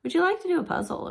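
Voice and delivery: high, fast eager invitation; upbeat parent offer; inviting parent. Shown as a demonstration. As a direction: coax the kid into play. Parent inviting child to play.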